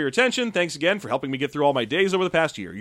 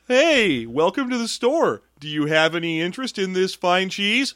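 high, slow, bright shop greeting; mock cheery clerk; 5 semitones higher, slower than usual. Improv shopkeeper greeting a customer, high and overly cheerful.

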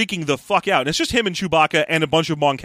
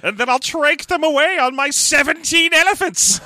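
sneering panto villain; 11 semitones higher than usual. Acted. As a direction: gloat over the loot, hammed up. Joins the villain bit.

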